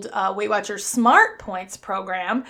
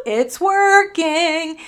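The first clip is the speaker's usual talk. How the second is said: excited repeat; 6 semitones higher, 4 dB louder, much slower than usual. Real, not acted.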